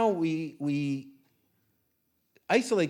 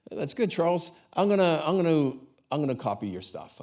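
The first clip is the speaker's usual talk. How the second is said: mock Italian accent; 5 semitones higher, 6 dB louder than usual. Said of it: "it's not a very good accent of him".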